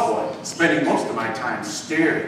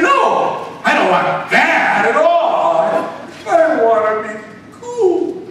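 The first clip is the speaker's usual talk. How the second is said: put-on much higher, louder whiny teen; whiny teenager; 8 semitones higher, 5 dB louder, slower than usual. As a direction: whine it like a stubborn kid. Teen insists to the genie.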